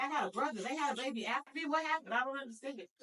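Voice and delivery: high, bewildered mock disbelief; mock bafflement. Teen learning of a brother, in high mock bewilderment. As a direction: act out the confused teen. Retelling shock at new brother.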